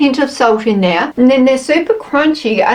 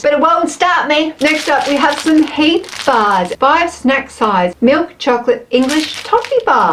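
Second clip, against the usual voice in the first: usual speaking voice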